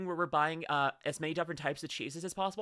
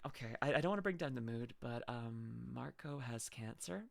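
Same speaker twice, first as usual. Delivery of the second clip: sad news; 7 semitones lower, 9 dB quieter than usual. Real, not acted. Tells chat her dog is ill.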